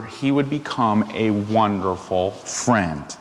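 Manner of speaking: ordinary delivery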